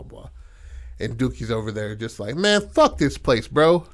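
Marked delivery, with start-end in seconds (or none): 2.4-3.9 loud, higher, mouthy brush-off. Retold or imitated. Teen rider griping about the course, mouthy. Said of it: "He's, like, real mouthy".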